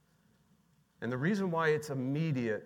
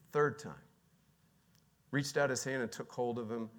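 ordinary delivery

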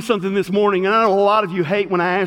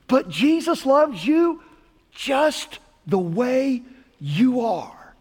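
tender, slowed-down emphasis; 2 semitones higher, slower than usual. Acted. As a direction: linger on every word, gently. Tender repeat of the key line.

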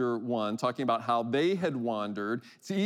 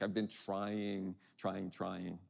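weary quote; 5 semitones lower, 9 dB quieter, slower than usual. Retold or imitated. Voicing a tired, failing believer.

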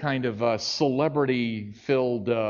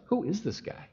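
inner thought; 6 semitones higher, 7 dB quieter than usual. Retold or imitated. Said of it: "he's thinking to himself".

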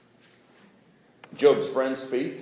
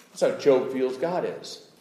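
louder, higher, driving sermon delivery; preaching at full force; 7 semitones higher, 8 dB louder than usual. Acted. Preacher pleading Job's case to the congregation, full force. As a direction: preach it hard to the crowd.

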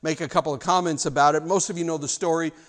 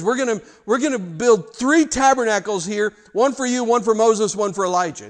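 eager, louder and higher blurting; goofy Peter; 4 semitones higher, 5 dB louder than usual. Acted. Peter blurting out a goofy plan on the mountain, excited. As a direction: gush out the plan all at once.

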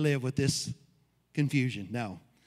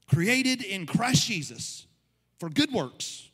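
higher, rising sermon voice, hammering each phrase; preaching at full force; 4 semitones higher, 4 dB louder than usual. Acted. Pastor preaches to his church, driving the point home. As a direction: build the congregation up with every line.

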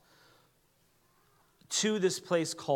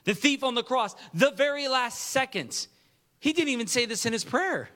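rising excitement; 7 semitones higher than usual. Real, not acted. Preacher builds to climax.

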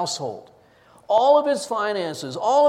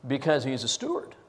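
normal talking voice

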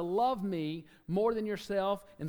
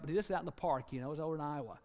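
usual speaking voice

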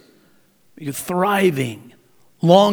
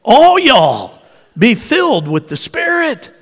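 big, high Southern holler; Texas twang; 7 semitones higher, 6 dB louder than usual. Shown as a demonstration. Preacher showing how Paul from Texas would command, loud Texas twang. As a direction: belt it out in a loud Southern accent. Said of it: "That's the Texas version of the command".

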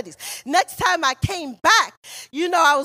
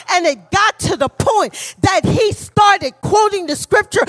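louder repeat with a rise on started; preaching climax; 3 semitones higher, 4 dB louder than usual. Acted. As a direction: punch the repeat up loud for the crowd.

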